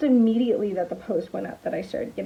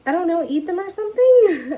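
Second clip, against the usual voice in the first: higher, louder exasperated retort; 8 semitones higher, 7 dB louder than usual. Retold or imitated. To customer who won't take pizzas, exasperated louder retort. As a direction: throw the answer back, fed up. Snapping back at customer on phone.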